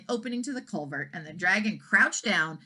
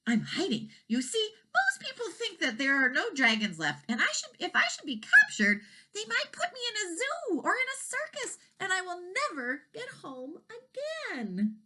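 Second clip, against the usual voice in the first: much higher, tearful dragon voice; scared baby dragon; 13 semitones higher than usual. A narrator's reading. Baby dragon fearing the zoo, high tearful voice. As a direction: plead it like a frightened little dragon.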